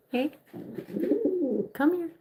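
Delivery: high, sing-song call to the bird; pet talk. Real, not acted. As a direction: coax the pet over in a high voice.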